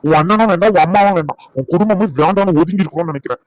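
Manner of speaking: heated, emphatic refusal; angry rejection. Acted. Husband refuses help from wife's brother, angry rejection. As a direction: push each one away in irritation.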